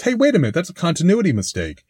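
catching a blooper; sudden realization. Retold or imitated. Host recalls spotting a supposed continuity error, surprised. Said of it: "first thought was".

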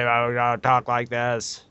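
buzzy speaker-box voice; electrolarynx voice. Retold or imitated. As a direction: talk through a throat speaker.

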